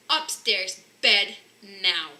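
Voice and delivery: loud roared order; roaring. A narrator's reading. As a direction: roar the bedtime order. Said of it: "roared Mister Bear".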